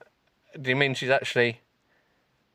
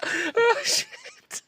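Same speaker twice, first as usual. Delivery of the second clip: laughing. Real, not acted. Laughing exclamation.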